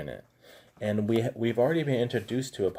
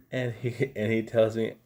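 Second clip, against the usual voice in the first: ordinary talk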